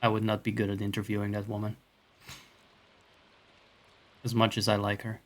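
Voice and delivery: ordinary delivery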